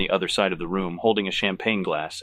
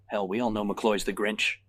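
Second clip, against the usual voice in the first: ordinary delivery, nothing marked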